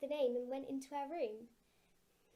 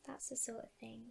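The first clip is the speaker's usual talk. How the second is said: whispering; 12 dB quieter than usual. A narrator's reading. Tender closing thought.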